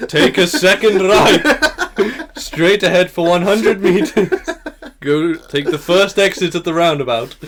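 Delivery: sat nav voice, laughing. Pretend. Mimicking sat nav directions, laughing.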